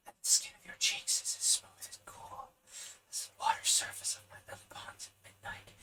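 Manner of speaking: airy, sighing wind whisper; breathy voice. Acted. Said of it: "the sighing voice continued".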